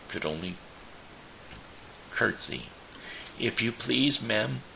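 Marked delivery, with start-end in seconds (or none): 3.4-4.6 put-on high, polite little-girl plea; sugary politeness to a witch; as sweet as possible. A narrator's reading. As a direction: ask sweetly, pitched well up. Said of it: "as sweetly as she could".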